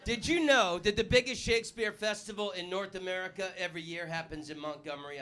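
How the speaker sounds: ordinary talk, nothing marked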